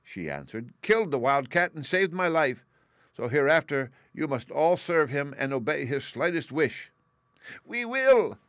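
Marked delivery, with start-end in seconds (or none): 0.8-6.9 high, loud tiny commanding voice; commanding mouse queen. A narrator's reading. Mouse queen commands her mice. 7.7-8.4 high, loud squeaky cry; shrill chorus. A narrator's reading. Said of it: "cried all the mice in a shrill chorus".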